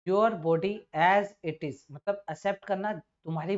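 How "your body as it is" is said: slow reading aloud. Shown as a demonstration. Teacher reads sentence aloud.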